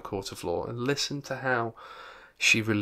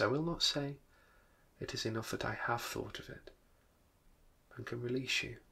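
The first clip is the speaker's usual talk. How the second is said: quiet; 8 dB quieter than usual. A narrator's reading. Girl's sad release speech.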